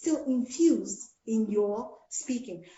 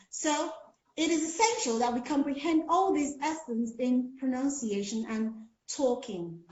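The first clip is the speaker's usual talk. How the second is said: usual speaking voice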